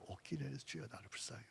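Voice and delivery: breathy hushed plea; whispered prayer. Shown as a demonstration. Preacher shows the change in a whispered Kyrie.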